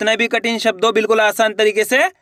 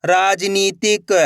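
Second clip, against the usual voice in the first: slow, stretched reading of the whole word; joined-up word; much slower than usual. Shown as a demonstration. Teacher showing learners the finished word, slowly joined up. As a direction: blend the syllables into one drawn-out word. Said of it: "तो मिलके बन जाएगा".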